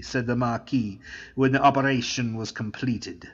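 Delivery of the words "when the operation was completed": ordinary delivery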